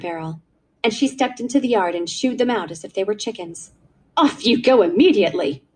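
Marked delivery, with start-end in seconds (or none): 4.2-5.6 haughty, called-out dismissal; cold and proud. A narrator's reading. Aunt sending the children out of the yard, cold and proud. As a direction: shoo them off with icy authority. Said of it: "she called, cold and proud".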